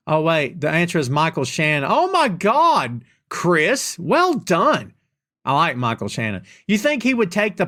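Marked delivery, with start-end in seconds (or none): none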